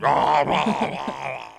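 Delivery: greedy cookie-monster style munching noises; wordless devouring "rah rah"; imitated gobbling noise. Imitates raiding the cookie case.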